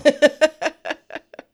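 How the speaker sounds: high, loud burst of laughter. Real, not acted. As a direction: crack up loudly. Amused by tuba denial.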